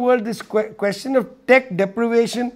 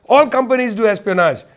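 ordinary talk, nothing marked

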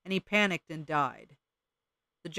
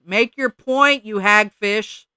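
slow, louder, higher bird screech; 5 semitones higher, 10 dB louder, much slower than usual. Acted. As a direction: screech the insult like an enraged bird. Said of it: "screeched the Junco".

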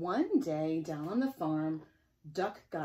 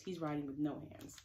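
ordinary delivery, nothing marked

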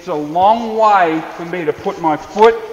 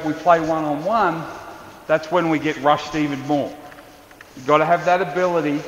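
usual speaking voice

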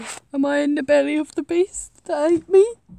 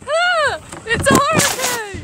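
high loud alarmed shout; alarmed cry; 7 semitones higher, 3 dB louder than usual. Pretend. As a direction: cry out at the storm. Pretend storm alarm.

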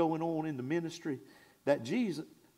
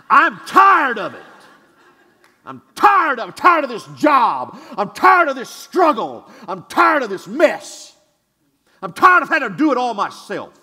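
loud preacher shouts of weariness; shouting the church's burdens from the pulpit; fed-up shouting; 6 semitones higher, 15 dB louder than usual. Shown as a demonstration. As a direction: pour out every frustration at full voice. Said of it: "like you mean it".

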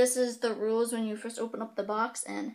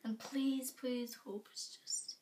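nervous whisper; 13 dB quieter, slower than usual. Real, not acted. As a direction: whisper a slow plea. Nervous before tasting a bean.

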